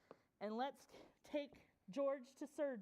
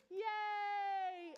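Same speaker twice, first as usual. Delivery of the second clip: drawn-out, higher cheer; playful cheer; 4 semitones higher, 3 dB louder than usual. Pretend. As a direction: cheer along for young listeners. Celebrates the puppy's bark.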